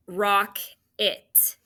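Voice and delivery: rock and it said as two separate beats; syllable split. Shown as a demonstration. Teacher clapping out syllables of rocket. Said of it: "That has two syllables".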